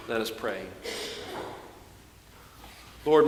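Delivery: low, measured scripture reading and prayer; liturgical reading. Acted. Preacher reading scripture and praying before the sermon, solemn low voice. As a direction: read it like the liturgy at the lectern.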